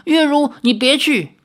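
high, loud plea; crying out. Acted. Xiaoyao calls after the vanishing Yueru in a dream, high and loud. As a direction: call her back in anguish. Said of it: "叫道".

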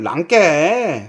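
very slow Jeolla dialect ending; a drawn-out Jeolla dialect flourish. Shown as a demonstration. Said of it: "전라도 사투리는".

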